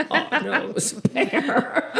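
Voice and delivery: high, slow mock alarm with a laugh; laughing mock fright; mock panic. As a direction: pretend dread of a bear, half laughing. Imagined panic about a bear.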